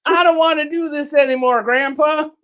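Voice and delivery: put-on high, loud whining child; whiny kid voice. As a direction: whine like a kid to grandpa.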